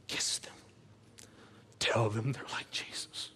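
hushed, slow whisper; a slow tender whisper; soft whisper. Real, not acted. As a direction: breathe it tenderly to the parents. Tender close of sermon point.

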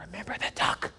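sly whispered threat. Retold or imitated. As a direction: hiss it as a secret reminder. Sister's repeated whispered threat.

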